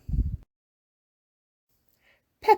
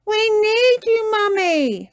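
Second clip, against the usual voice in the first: high, loud plea; crying out; 6 dB louder than usual. A narrator's reading. Peppa begs Mummy for help, high loud cry. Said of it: "cried Peppa".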